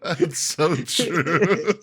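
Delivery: drawn out through laughter; laughing. Real, not acted. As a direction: laugh as you say it slowly. Laughing at friend's story.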